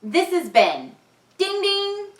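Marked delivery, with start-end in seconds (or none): 1.4-2.1 high ringing ding; bike bell. Acted. Bell of Ben's bike, high and ringing. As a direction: ring it like a bell.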